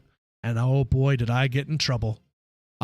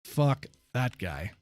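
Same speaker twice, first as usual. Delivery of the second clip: slow, low and soft brush-off; mock dismissive; 6 semitones lower, 5 dB quieter, much slower than usual. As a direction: drag out a mocking kiss-off under the breath. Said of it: "I was like".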